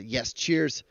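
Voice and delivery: ordinary talk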